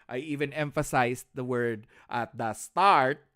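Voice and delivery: loud exasperated correction; exasperated scolding. Real, not acted. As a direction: raise your voice at the wrong answer. Teacher scolding a wrong answer.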